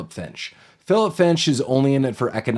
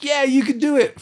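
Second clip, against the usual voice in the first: very high-pitched imitation cheer; pitched-up parody of rooting someone on; mock cheering; 13 semitones higher than usual. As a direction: squeal encouragement like a fan in a movie finale. Voicing the cheer at film's end.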